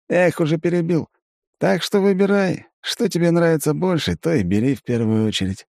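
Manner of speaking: high, scheming elf voice; a grinning elf with a plan; Aiven. A narrator's reading. Elf urges looting.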